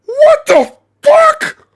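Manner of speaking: high, stretched shocked cry; shocked outcry. Real, not acted. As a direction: gasp out the words in disbelief. Streamer reacts shocked to clip.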